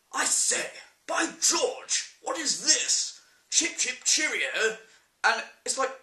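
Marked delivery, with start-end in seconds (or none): 0.1-4.8 high, hammed-up posh British accent; over-the-top mock British actor; exaggerated British. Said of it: "where there's British actors".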